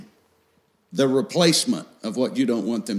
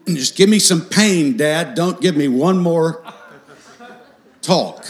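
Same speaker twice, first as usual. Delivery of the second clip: higher, louder mock plea to Dad; begging child; 6 semitones higher, 7 dB louder than usual. Children imitated begging Dad for no more talks, mock pleading. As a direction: beg loudly, hammed-up, like a fed-up kid. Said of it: "my children would be begging me".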